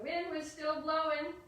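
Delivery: high, loud, swelling line; big performed refrain, stretched at the end; wind demonstration. Shown as a demonstration. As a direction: blow the words out like gusting wind. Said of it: "We have to do our wind demonstration".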